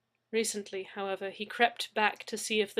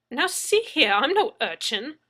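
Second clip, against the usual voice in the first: indignant, higher and louder protest; objecting; 5 semitones higher, 10 dB louder than usual. A narrator's reading. Leslie objects to Mad Zeke, indignant and loud. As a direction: bristle with offended pride. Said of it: "I objected".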